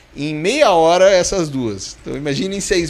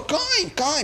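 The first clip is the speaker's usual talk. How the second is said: high-pitched imitation of a little old lady; 11 semitones higher, 4 dB quieter than usual. Old lady prompting a passenger holding up the queue, high imitated voice. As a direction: chirp it high as the lady behind. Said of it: "uma senhorinha atrás de mim falou".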